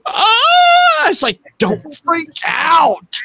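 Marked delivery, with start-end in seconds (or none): 0.1-1.1 high-pitched mock shriek; imitated scream. Imitating wife seeing the spider, high mock scream. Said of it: "And she's like". 1.6-3.2 high, slow, strained mock shout; mock panic. Telling screaming wife, high panicky mock shout.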